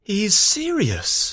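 surprised. A narrator's reading. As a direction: say it slowly in surprise. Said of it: "Don Pedro said in surprise".

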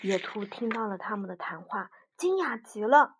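ordinary delivery

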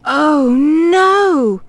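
high, loud wail of dismay; hammed-up storybook "oh no". A narrator's reading. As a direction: draw out the "no" in mock despair. Dog dismayed at another lost voice.

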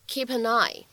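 "keep an eye" run together as kee-pa-neye; linked pronunciation. Shown as a demonstration. Teacher demonstrating two links in "keep an eye", linked pronunciation. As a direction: link P into "an", N into "eye". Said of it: "其中会有两处连读".

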